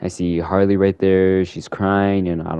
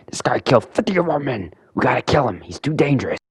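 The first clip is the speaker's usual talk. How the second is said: higher, urgent thug voice; Penguin voice; 6 semitones higher than usual. Retold or imitated. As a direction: voice a panicked mob boss.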